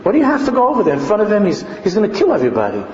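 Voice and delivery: high, fast, exasperated complaining; acting out upset onlookers; upset protest. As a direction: protest in a rush of high-pitched questions.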